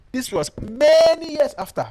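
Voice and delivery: MANY drawn high and loud; stress on MANY. Real, not acted. Storyteller dating the snake tale, MANY stretched high and loud. Stressing how much later it was.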